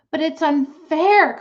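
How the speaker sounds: high, loud, slow protest; crying out. A narrator's reading. Fern cries out to her father that it's unfair. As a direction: cry out against the unfairness. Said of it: "cried Fern".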